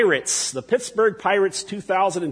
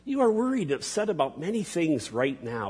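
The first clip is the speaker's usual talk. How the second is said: tender Jesus; 6 dB quieter than usual. Acted. Jesus calming anxious Martha, soft and tender. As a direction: hush it like comforting a friend. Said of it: "a term of intimacy and endearment".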